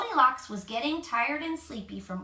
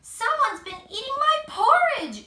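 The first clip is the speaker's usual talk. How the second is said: much higher and louder shriek; shrieking Mama Bear; 14 semitones higher, 9 dB louder than usual. A narrator's reading. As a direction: shriek at the empty porridge bowl. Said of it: "she shrieked".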